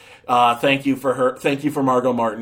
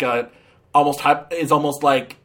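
usual speaking voice

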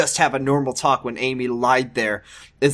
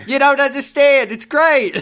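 much higher, louder, chipper; cheery high doctor; 10 semitones higher, 5 dB louder than usual. Acted. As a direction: sell it with goofy cheer.

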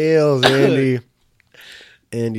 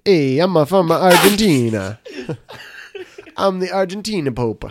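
put-on Italian accent; 6 semitones higher, 7 dB louder than usual. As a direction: overdo a cartoon Italian accent. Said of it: "he sounds like".

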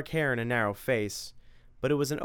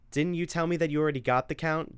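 higher-voiced mom, quick and fed up; a mother scolding her son about morning video games; nagging mother; 6 semitones higher than usual. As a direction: lay down the house rules.

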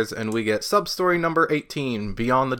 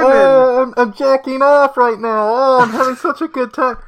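muffled; 12 semitones higher, 9 dB louder than usual. Acted. Joins the muffled voices.

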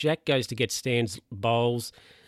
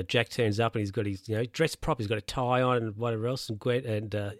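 ordinary talk

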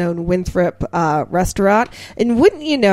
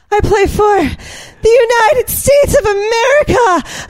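high, loud, near-crying declaration; proud shout; 4 dB louder than usual. Retold or imitated. Player answering the coach, shouting with emotion. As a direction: cry it out with full pride. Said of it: "And he says".

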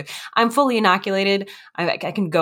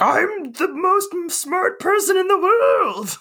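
high, drawn-out crazed boasting; hammed-up mad genius wail; Mad Hatter-like mania; slower than usual. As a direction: crow it way up high, stretching every word. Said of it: "feel a little bit like Mad Hattery".

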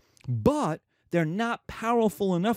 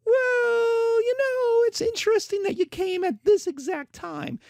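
much higher, sing-song fake-polite official; mocking Assyrian official. Assyrian librarian turning away a pilgrim, high mock-polite voice. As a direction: sweetly brush off the pilgrim in a high put-on voice.

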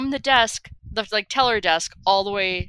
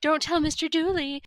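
higher-pitched imitation of panicked pleading; mock freak-out; 7 semitones higher than usual. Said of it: "like, freaking out".

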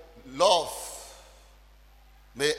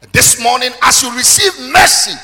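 full-force preaching; 4 semitones higher, 13 dB louder than usual. Acted. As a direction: thunder the scripture at the congregation. Preaching climax.

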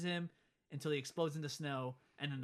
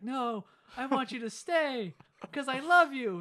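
higher, slow and louder plea; mock pleading dad; 8 semitones higher, 7 dB louder, slower than usual. Dad begging his wife to stay, hammed-up plea. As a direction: ham up a desperate love line.

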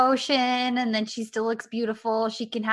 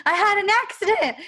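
high, hammed-up tearful whine; mock dramatic; 9 semitones higher, 4 dB louder than usual. Sun M playing it up after the bike crash, mock dramatic whine. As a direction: ham up her fake distress. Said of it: "before she's being all dramatic".

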